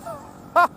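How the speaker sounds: short high burst of laughter; real amused laugh. Real, not acted. Laughs at getting the name.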